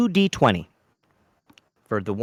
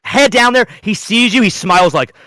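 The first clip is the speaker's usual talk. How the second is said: normal talking voice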